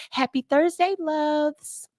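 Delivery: a touch of a chirpy teacher; Teacher [name] voice. Retold or imitated. Host reading a viewer's comment in a teacher voice. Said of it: "in my Teacher [name] voice".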